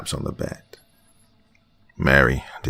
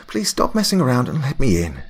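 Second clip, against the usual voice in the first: higher, lightly amused friend; Mary pleading; 9 semitones higher, 4 dB louder than usual. Acted. Mary asking to be let in, higher. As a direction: coax through the door.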